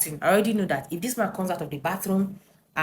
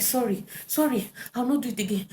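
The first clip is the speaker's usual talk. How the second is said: mimicking pleading husband; 7 semitones higher than usual. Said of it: "He was saying".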